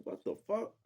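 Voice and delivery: can't believe what you just heard; shocked disbelief. Retold or imitated. Told snakes in the pool.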